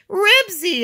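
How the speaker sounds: loud, high shout of the name; high, full-voiced call; yelling. A narrator's reading. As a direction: yell after your runaway dog. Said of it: "yelled Henry".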